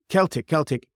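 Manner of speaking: "Celtic" as KELL-tick, said twice; British "Celtic" with hard K. Shown as a demonstration. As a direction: begin each "Celtic" with a K. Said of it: "everyone in Britain says Celtic".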